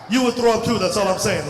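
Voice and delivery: ordinary delivery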